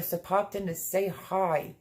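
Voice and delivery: usual speaking voice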